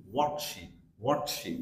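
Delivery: ordinary talk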